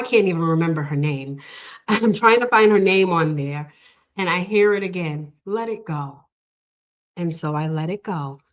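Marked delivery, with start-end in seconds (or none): 5.4-6.2 softly voiced inner message; gentle inner voice. Inner voice repeats to the searching assistant, soft gentle counsel. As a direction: let it arrive quietly, as calm guidance.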